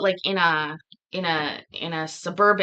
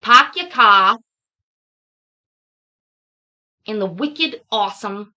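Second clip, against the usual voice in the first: put-on r-less Boston accent, loud at the start; attempted Boston accent; 5 dB louder than usual. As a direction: put on a halting Boston accent, dropping r's. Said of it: "I can't even say it like they would say it".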